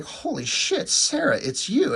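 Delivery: high-pitched mock amazement; astonished. Partner finds the missing Sarah at the door, astonished. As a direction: voice jumps up in startled recognition.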